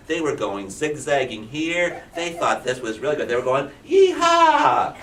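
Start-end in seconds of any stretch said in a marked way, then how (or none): 3.8-4.9 high, loud whooping cheer; a frog whooping with joy; wild high cowboy yell; gleeful yee-haw. Acted. Frogs cheering.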